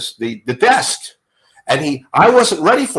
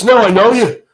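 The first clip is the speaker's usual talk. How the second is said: higher, louder Liverpool insistence; 10 semitones higher, 6 dB louder than usual. Retold or imitated. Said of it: "your [name] imitation".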